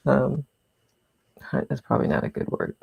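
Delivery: ordinary talk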